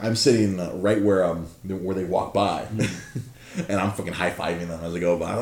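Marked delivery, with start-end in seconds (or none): none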